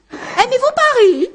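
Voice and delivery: a prim lady making salon conversation; slow, high, affected society question; polite small talk. Acted.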